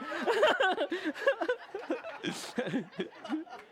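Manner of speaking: high laughing burst; burst of laughter. Real, not acted. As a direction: let a real laugh burst out. Laughter after rhetorical question.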